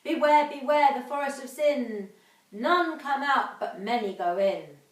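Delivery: lightly voiced mother's eerie warning; ominous warning. A narrator's reading. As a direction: chant it like an old rhyme.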